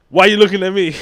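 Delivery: hammed-up yell from the friend caught laughing; mock defensive yell. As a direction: bark it back like you've been accused. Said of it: "and Cam's like".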